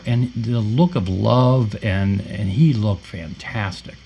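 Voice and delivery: usual speaking voice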